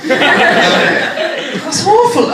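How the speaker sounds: high, drawn-out Welsh horror; Welsh accent. Retold or imitated. Welsh nurse appalled by the news, hammed-up Welsh accent. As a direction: stretch it slowly in a high Welsh lilt. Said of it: "she's also from Wales".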